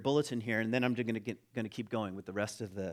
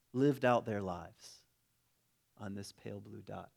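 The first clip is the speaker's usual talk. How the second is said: oratorical reading; 5 semitones higher, 5 dB louder than usual. Acted. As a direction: ring out each phrase, then let it settle. Reads a passage aloud with feeling.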